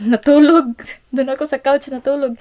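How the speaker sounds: ordinary talk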